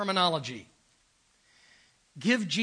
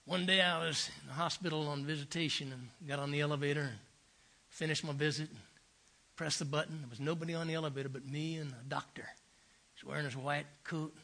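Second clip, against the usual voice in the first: put-on Southern accent; 4 semitones lower, 6 dB quieter than usual. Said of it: "marvelous Southern accent".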